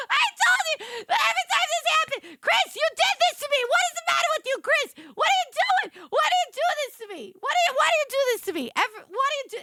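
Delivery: high, loud, fast mock complaint; mock whine. Retelling her complaint to Chris, a high loud mock whine. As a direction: whine at Chris like a wronged friend. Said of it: "retelling her own complaint to Chris".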